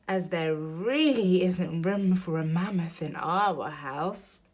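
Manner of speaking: plain reading voice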